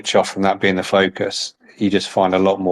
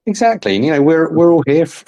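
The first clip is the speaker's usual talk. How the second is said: eager agreement; 8 semitones higher, 3 dB louder than usual. Real, not acted.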